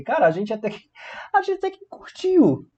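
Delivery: high-pitched playful voice for personified verbs; verbs talking. Acted. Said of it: "o talk e os demais verbos falaram assim". (voz aguda dos verbos falando)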